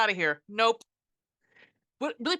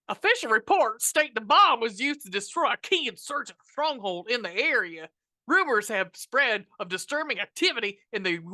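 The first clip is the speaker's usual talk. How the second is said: put-on worst Southern accent; 4 dB louder than usual. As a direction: read it in an awful fake Southern accent. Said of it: "in the worst Southern accent".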